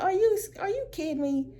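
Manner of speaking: high, incredulous exclamation; disbelief. Retold or imitated. On seeing the passenger complain, high disbelieving cry. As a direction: sound like you can't believe it. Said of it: "I said".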